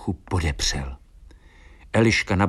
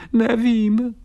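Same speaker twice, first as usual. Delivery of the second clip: very high, thin little whine; whimpering swallow; 14 semitones higher, 4 dB louder than usual. A narrator's reading. Swollen swallow answers worried friend, high whimper. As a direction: whimper it like a tiny bird. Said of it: "zafňukala oteklá Eliška".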